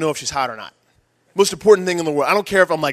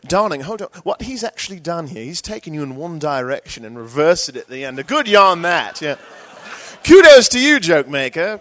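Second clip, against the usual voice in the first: British audience member; 4 dB louder than usual. Retold or imitated. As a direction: explain the joke in a put-on British accent. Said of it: "this is what the crowd would do".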